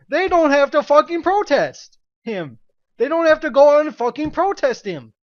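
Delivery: exasperated ranting yell; angry shout. Real, not acted. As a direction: shout it at them, fed up. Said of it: "I just wanna scream at this shit".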